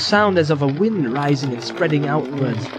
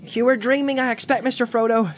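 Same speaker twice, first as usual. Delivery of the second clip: Sam voice; 8 semitones higher than usual. A narrator's reading. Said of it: "said Sam".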